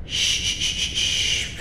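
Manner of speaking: soft, drawn-out shush; soothing shush. Acted. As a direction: hush the captive gently. Queen hushes her captive.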